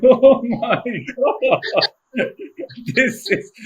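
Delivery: high, slow gasp of delight, laughing; stunned and thrilled by a stranger's praise; delighted disbelief. Retold or imitated. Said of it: "I felt elated".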